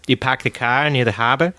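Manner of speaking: bad Boston accent imitation. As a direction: drop the Rs, Boston style. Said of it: "you're butchering that".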